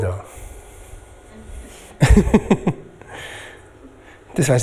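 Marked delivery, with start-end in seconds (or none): 2.0-2.7 quick pulsing laugh; chuckle. Real, not acted. Preacher finding the glass trick gone wrong, chuckle. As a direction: chuckle at your own mishap.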